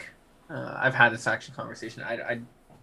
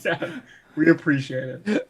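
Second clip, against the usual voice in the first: slow, drawn-out words through laughter; amused, stretched-out delivery; laughing thanks; 3 semitones higher, slower than usual. Real, not acted. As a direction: laugh your way through a joking thank-you. Piling on mock thanks, laughing.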